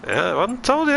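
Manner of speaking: sing-song tease. Acted. Streamer teasing chat, sing-song.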